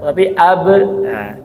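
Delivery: usual speaking voice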